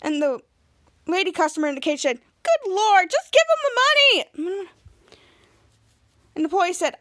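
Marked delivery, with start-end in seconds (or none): 2.4-4.7 high, loud, drawn-out plea; exasperated yell. Retold or imitated. Lady customer in the cage, exasperated yell. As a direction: cry out as a fed-up customer.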